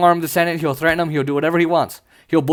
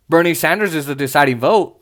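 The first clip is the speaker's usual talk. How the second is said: normal talking voice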